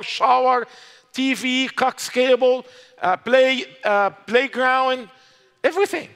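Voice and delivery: fervent preacher's exhortation; impassioned preaching. Real, not acted. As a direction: rebuke them for being afraid, voice climbing high. Preacher rebuking afraid Christians.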